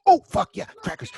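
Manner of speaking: high, loud burst of put-on enthusiasm; mock excitement. Kitchen answers the request for crackers, hammed-up excitement. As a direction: explode with exaggerated delight, pitch leaping up.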